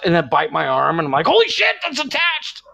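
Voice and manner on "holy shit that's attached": very high squealed mock outrage; alarmed yelp. Husband reacts to wife biting his arm, high yelp. Retold reaction to being bitten.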